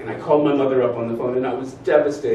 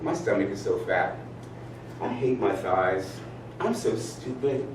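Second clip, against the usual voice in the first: soft, slow self-critical mutter; negative self-talk; 5 semitones lower, 8 dB quieter than usual. Retold or imitated. Inner critic putting yourself down, soft and slow. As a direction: murmur it like your inner critic. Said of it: "that negative speak".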